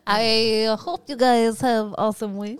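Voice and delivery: slow, loud mock Scottish accent. Shown as a demonstration. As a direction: put on a hammy Scottish brogue. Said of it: "I'll do it in a Scottish accent".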